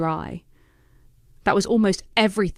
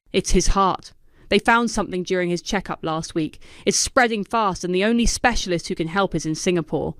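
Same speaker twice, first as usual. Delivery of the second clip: ordinary delivery, nothing marked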